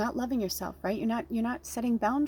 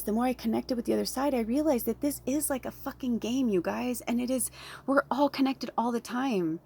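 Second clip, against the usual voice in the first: ordinary talk, nothing marked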